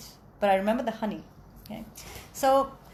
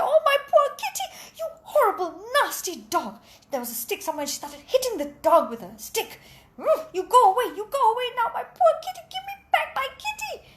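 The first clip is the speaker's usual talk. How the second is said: shrill cat owner; 11 semitones higher, faster than usual. Acted. Cat owner to the dog, a hammed-up much higher shriek. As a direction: shoo the dog off your kitty in hammed-up outrage. Angry owner voiced in a story.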